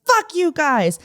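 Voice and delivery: high-pitched mock-furious curse; imitated angry yelling. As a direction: act out a defiant man yelling back. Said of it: "he's yelling at me".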